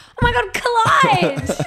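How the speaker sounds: high, drawn-out mock squeal; hammed-up fan shriek; imitating starstruck fans. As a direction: gush it loudly, stretching the name. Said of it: "people being like".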